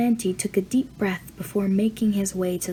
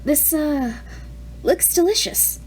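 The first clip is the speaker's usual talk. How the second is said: put-on higher, halting teen voice; nervous stammer; 7 semitones higher than usual. A narrator's reading. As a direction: stumble to fill the awkward silence. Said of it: "the boy stammered".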